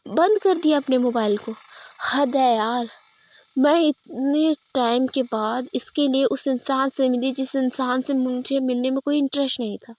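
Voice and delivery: loud, irritated outburst; friend's phone is off, loud angry rant. Acted. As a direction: fume at the friend who switched off her phone. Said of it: "निधि को बहुत गुस्सा आता है".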